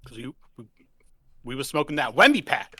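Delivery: ordinary delivery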